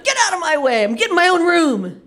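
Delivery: put-on loud, high kid's yell; defiant shout. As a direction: shove past him and shout it. Kid shouting at brother.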